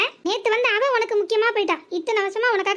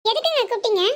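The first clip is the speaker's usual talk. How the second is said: sweet dutiful wife; 3 semitones higher than usual. Acted. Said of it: "மகாராணி வந்து கேட்காவ".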